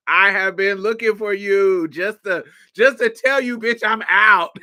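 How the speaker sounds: high and loud, bursting with pride; an excited, proud coming-out shout; excited declaration. Retold or imitated. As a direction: blurt it out like big news. Retelling his excited announcement.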